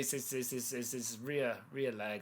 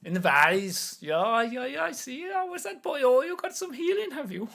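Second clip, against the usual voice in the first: put-on high, loud old Irish woman; a much higher old village woman voice; old woman Millie; 11 semitones higher, 10 dB louder than usual. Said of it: "I'm doing Millie".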